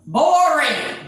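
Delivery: mocking. Real, not acted. Mocking the boring partner.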